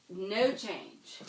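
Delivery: ordinary talk, nothing marked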